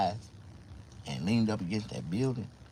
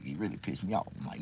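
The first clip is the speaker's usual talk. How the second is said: ordinary delivery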